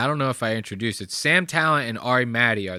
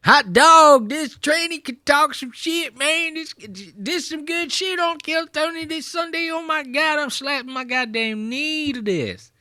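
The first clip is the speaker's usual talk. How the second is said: exaggerated Texas yokel. Comic voicing the cheering Texas crowd, hammed-up Texas accent. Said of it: "You know how they talk down there in Texas".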